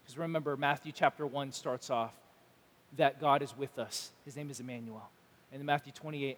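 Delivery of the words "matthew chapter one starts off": ordinary talk, nothing marked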